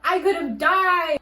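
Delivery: high, loud re-enacted outrage, drawn out; retold yelling. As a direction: yell it in outraged disbelief, stretching the words. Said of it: "yelling at the top of my lungs".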